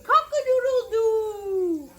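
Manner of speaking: high, loud crow; crowing rooster. Acted. Flustered fowl crowing, high and loud. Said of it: "who crowed".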